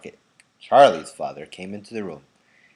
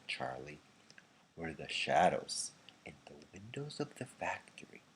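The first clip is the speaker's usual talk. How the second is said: much softer, hushed grandfather; softly; 3 semitones lower, 8 dB quieter than usual. A narrator's reading. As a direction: lean in and tell the secret softly. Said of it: "he said softly".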